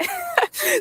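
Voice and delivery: a sudden high giggle bursting out; high burst of laughter. Real, not acted. Laughing at how the story ended, a high giggle. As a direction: laugh it off, squeaky and bright.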